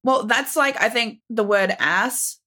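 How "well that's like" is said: usual speaking voice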